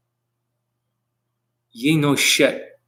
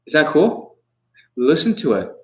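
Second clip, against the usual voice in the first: ordinary delivery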